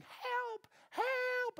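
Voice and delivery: very high mock cry for help; imitated screaming girl. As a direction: squeal it like a panicked girl, without full volume. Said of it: "a girl screaming".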